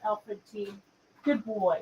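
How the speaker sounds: ordinary talk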